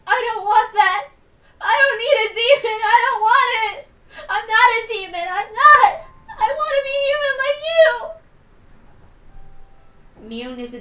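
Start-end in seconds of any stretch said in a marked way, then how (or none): 0.1-7.9 high, much louder, crying out in desperation; sobbing scream. Acted. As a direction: scream it through tears, refusing the demon. Shion rejecting the demon, sobbing.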